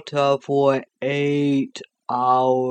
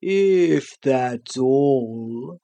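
sleepy creature voice; much slower than usual. Acted. The Sloth's voice.

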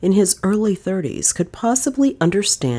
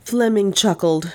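ordinary delivery

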